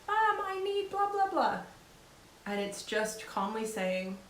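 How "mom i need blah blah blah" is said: put-on high whiny kid voice for "Mom, I need blah"; child's voice. Daughter barging into the room, high whiny child voice. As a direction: pitch it up like a little girl barging in. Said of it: "opens the door".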